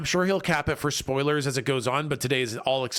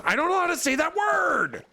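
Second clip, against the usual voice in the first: exasperated shout; 13 semitones higher than usual. Real, not acted. Streamer failing a Pokemon name: exasperated shout. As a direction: throw up your hands and shout it. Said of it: "frustrated outburst".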